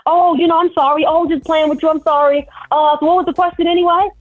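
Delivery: high, hurried mock apology; hasty backpedalling. Comedian imitating harasser caught on camera, hasty backpedalling. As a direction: imitate him scrambling to smooth it over.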